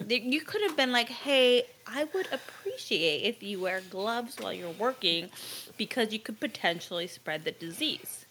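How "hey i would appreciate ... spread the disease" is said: calm. Shown as a demonstration. Showing the lady how to ask for gloves, calm. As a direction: reason gently, softening toward the end. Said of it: "See how calm I am?".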